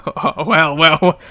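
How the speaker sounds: ordinary talk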